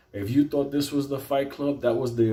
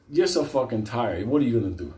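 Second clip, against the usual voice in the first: normal talking voice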